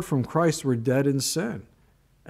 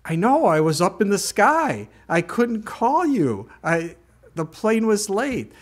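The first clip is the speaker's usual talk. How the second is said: higher, pleading exasperation, slowing down; exasperated plea; 6 semitones higher than usual. Retold or imitated. Pastor retelling his plea to the rental clerk, high and exasperated. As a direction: plead that the plane was late. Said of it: "I said".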